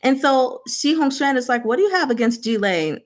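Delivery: ordinary talk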